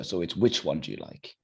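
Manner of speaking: ordinary talk, nothing marked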